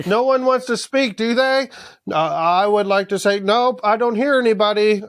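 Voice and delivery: high, put-on chairman voice; mock dismissive council. Council pretends not to hear Beesbury, mock dismissive. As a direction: pitch it up, pretending nobody objects.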